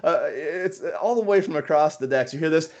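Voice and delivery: ordinary talk, nothing marked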